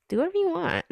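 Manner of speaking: high, playful toss-off. Real, not acted. Telling viewers to do whatever they like, high and playful. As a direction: shrug it off with a high laugh.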